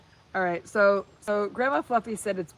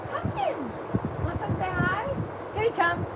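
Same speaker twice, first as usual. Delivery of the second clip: cooing to the horse; 9 semitones higher, 3 dB quieter than usual. Real, not acted. Owner cooing hello to her pony, high and soft. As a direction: coax him sweetly, way up high. Calling horse, baby talk.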